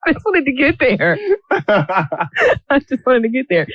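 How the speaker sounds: talking through helpless laughter; laughing. Real, not acted. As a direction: squeeze the words out between laughs, high-pitched. Cracking up over the long trip.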